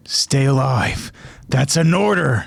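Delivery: slow, firm captain voice; stern command. Acted. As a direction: give a calm stern order. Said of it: "Captain Levi gives out his command".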